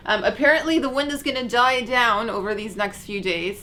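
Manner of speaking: normal talking voice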